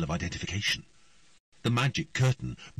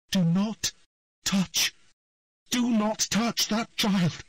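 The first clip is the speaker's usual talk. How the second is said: high, raised desperate order; loud plea; 13 semitones higher, 5 dB louder, slower than usual. A narrator's reading. Triss commands the voice to spare the child, loud. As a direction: forbid it with all you have.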